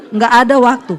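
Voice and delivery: ordinary delivery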